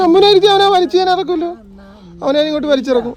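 high, loud playful threat; mock threat. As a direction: bluster about dragging Shambhu out, half joking. Teacher refuses helper, mock threat. (കളിയായി ഉച്ചത്തിൽ ഭീഷണി)

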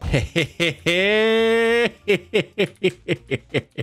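long, high stuttering cackle; gloating cackle. Pretend. About to take a buddy down, gloating high cackle. Gloating before "you're going down.

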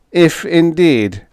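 high, slow, mildly weak alien voice; weak, trembling voice. A narrator's reading. Fifth echoing Miss Mule, weak trembling voice. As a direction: draw it out high, a touch tremulous. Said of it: "his weak voice trembling".

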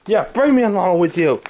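usual speaking voice

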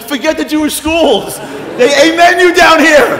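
high, full-volume comic excitement; elated shouting. Retold or imitated. Husband tells wife to forget the schools, elated shouting. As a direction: yell it, wildly thrilled and high.

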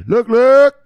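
high, loud "look, look"; excited call. Real, not acted. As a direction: burst of excitement. Excited calling attention.

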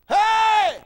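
loud, high-pitched holler to someone far off; one long sustained call across the dark. Retold or imitated. Lost coon hunter calling to an old man with a lantern, hollering. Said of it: "I was a hollering".

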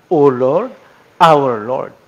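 "O Lord, our Lord" loud, with LORD stressed; emphatic. Shown as a demonstration. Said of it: "kumbaga, emphatic".

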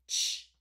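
loud, quick "Ch" burst; short ch sound. Shown as a demonstration. Teacher checking the last sound, short "Ch". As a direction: punch out "Ch" with no vowel. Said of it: "I hear a short air coming out".

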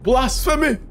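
high-pitched mock indignation; hammed-up scandalized exclamation; mock outrage. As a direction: cry it out in playful outrage. Mock outrage at rival god.

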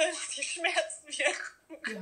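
laughing slowly through the words; nervous laugh mid-sentence. Real, not acted. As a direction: let a nervous laugh carry it.

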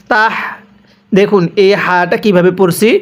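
usual speaking voice

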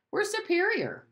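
high-pitched, self-important declaration; lofty little boast, pitch lifted high; boastful. A narrator's reading. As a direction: announce it like a boy parroting his father. Boy boasts of his country.

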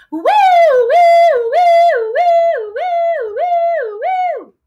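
high, loud wailing "woo"; fire truck siren. Shown as a demonstration. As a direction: sing repeated siren swoops, fading. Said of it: "a fire truck siren".

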